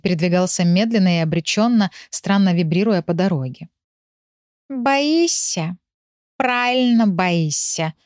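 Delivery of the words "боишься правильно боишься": drowsy, drawn-out, higher-pitched verdict; sleepy animal. A narrator's reading. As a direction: state it slowly, half asleep. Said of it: "констатировал заспанный Рыжик". (заспанный Рыжик тянет слова)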